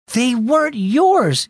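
mild yell. Acted. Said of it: "yelled Mia".

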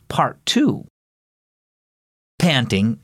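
ordinary delivery, nothing marked

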